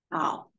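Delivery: 好 dipping low in the third tone; hao third tone. Shown as a demonstration. Teacher demonstrating third-tone 好. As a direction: let 好 dip and rise. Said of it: "Third tone".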